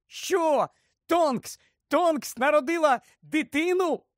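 very high squealed questions; excited shriek. A narrator's reading. Hermione hears of the baby, shrieking in amazement. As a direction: squeal it in astonished delight. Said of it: "заверещала".

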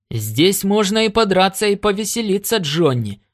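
a touch of eager relish; gleeful exclamation. A narrator's reading. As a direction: exclaim to a pal, pitch falling on the name. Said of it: "воскликнул Коннор".

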